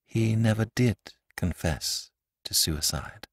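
low and slow, every word clear; low, distinct voice. A narrator's reading. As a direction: say it low and deliberate. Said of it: "in a low, distinct voice".

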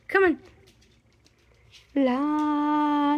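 ordinary talk

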